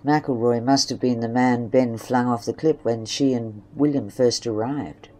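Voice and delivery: ordinary delivery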